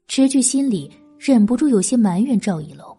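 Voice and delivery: ordinary delivery, nothing marked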